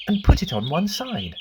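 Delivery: plain reading voice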